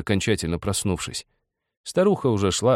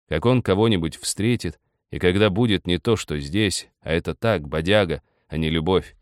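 plain reading voice

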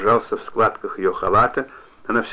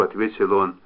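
usual speaking voice

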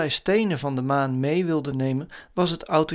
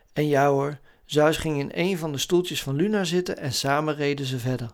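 ordinary delivery

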